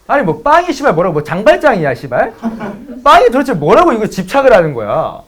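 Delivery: loud, high-pitched comic rant; exasperated outburst. Retold or imitated. Boyfriend venting about an obsession with bread, exasperated outburst. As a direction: let the bottled-up frustration burst out in disbelief. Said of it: "속으로 그 생각했어".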